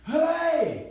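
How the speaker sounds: sharp loud "Hey!" to stop a deer; shout. Retold or imitated. Hunter shouting at a deer to stop it, loud. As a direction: yell it out high and loud. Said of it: "And then I'm like".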